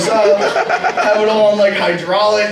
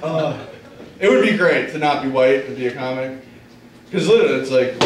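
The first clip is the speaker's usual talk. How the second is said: usual speaking voice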